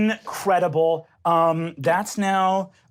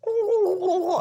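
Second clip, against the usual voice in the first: high, tender little coo; cooing pet; 12 semitones higher than usual. Acted. Jeremy cooing over his newborn. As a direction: coo like a proud parent beast.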